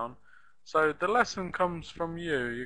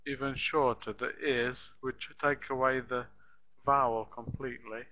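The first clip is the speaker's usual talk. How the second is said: normal talking voice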